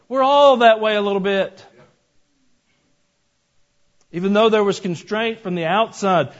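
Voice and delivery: loud, high preaching cry. Acted. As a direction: drive the point home to the congregation. Preaching at full force.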